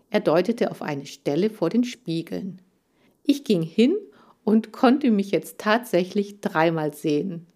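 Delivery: ordinary delivery, nothing marked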